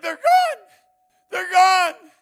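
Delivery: high, loud cry of release; crying out in relief. Retold or imitated. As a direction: shout it out, overwhelmed with relief. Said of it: "She begins to cry".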